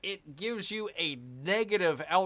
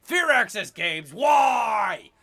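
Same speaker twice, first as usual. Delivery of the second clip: loud, much higher exasperated yelling at the game makers; frustrated shout; 8 semitones higher, 14 dB louder, much slower than usual. Real, not acted. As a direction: shout your frustration at the developers.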